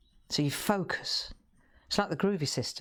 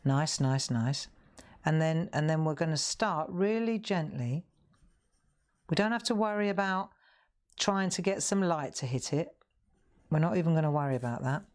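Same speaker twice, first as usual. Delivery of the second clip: usual speaking voice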